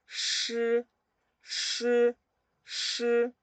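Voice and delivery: "shi" blown with tongue curled back on the palate; high, level "shi" on a steady stream of air. Shown as a demonstration. Teacher showing SH to learners, blown retroflex "shi". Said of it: "Y hacen soplo".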